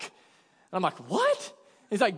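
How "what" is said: high, startled disbelief; shocked what. Retold or imitated. Told by the fighter to lie down, high shocked what. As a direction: yelp it out in disbelief. Told to get on his back.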